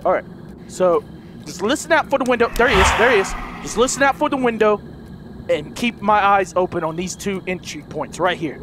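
usual speaking voice

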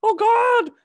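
high, loud panicked cry; terrified cry. A narrator's reading. Son crying out in fear at the mermaid, high and loud. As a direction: gasp it out in terror. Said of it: "stammered Hank".

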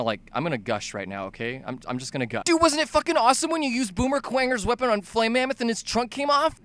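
ordinary talk, nothing marked

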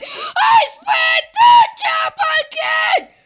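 high, loud shout broken by laughter; laughing shout. Real, not acted. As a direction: yell through the giggles. Laughing after failed jump.

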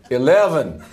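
bad American accent that sounds Irish; attempted American accent. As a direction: have a hopeless go at American. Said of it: "You need to try an American accent".

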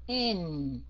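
N held, sliding down into the nose; nasal falling N. Shown as a demonstration. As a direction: let the end of N hum low. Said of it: "Cái âm N ở cuối mình sẽ xuống giọng mũi". (N ngân, xuống giọng mũi)

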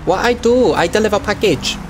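hammed-up Chinese-style accent in broken English; mock broken-English accent. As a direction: play a delivery driver with a crude Chinese accent. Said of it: "the worst and most offensive accent".

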